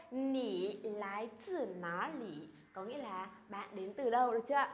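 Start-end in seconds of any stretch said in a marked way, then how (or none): 0.1-2.5 slow, careful Mandarin question; slow, syllable by syllable Chinese demo; slow model reading. Shown as a demonstration. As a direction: read it out slowly for students to copy. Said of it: "Vậy cả câu".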